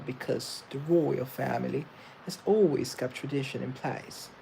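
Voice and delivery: proper British RP; British royal accent. Shown as a demonstration. Demonstrating the royal family's speech, proper British accent. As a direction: speak the Queen's English, very proper. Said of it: "So that's sort of British I P".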